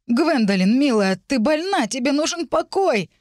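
громче и выше, с нарастающей тревогой; встревоженно уговаривай, поднимая голос к концу; встревоженные уговоры. A narrator's reading. Муж в панике уговаривает жену. (взволнованно упрашивает)

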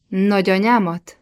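ordinary delivery, nothing marked

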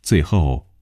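ordinary delivery

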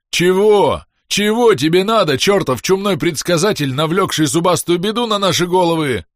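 inhuman howling voice, high and loud; eldritch horror. A narrator's reading. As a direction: howl it like a thing from the ceiling. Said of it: "завыл с потолка нечеловеческим голосом". (нечеловеческий вой)

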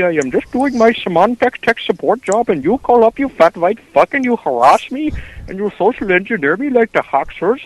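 exaggerated Indian accent, angry call-center worker telling off a prank caller; bad Indian accent. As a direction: rant in a hammed-up sing-song Indian accent. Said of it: "That's the worst accent".

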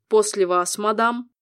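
gallant. A narrator's reading. Demon gallantly lets her pass.

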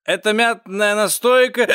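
high, drawn-out call; cat's loud complaint to waiter. A narrator's reading. Cat calls waiter again.

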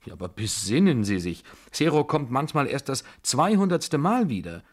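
high, loud, urgent protest; agitated warning; alarmed pleading. A narrator's reading. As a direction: beg her to see reason. Narrator pleading against reckless bet.

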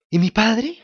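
high, loud worried cry; anxious. Acted. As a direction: ask it in sudden fear. Pinocchio fears for his father.

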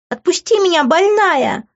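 very high-pitched squeal of protest; piercing, indignant shriek. A narrator's reading. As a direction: squeal to be let go. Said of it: "визжала Иви".

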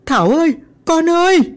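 high lament, climbing on the last call; panicked wailing. A narrator's reading. Mother clutches her trembling daughter, crying out in high panic. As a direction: wail out for your child.

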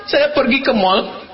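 ordinary talk

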